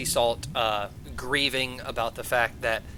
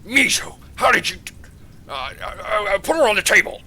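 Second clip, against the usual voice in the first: high, loud, stammering surprise; flustered father king; 8 semitones higher, 6 dB louder than usual. Acted. Father shocked at his daughter's captive, flustered. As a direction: stammer in alarm as the startled father.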